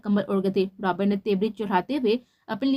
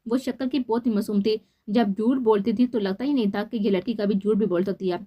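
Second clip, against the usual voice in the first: ordinary delivery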